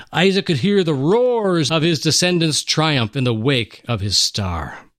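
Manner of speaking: usual speaking voice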